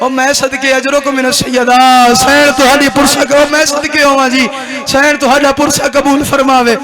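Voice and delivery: slow, wailing lament chant; keen the lines like a majlis elegy; mourning recitation. Acted. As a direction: Muharram masaib lament, drawn-out and weeping.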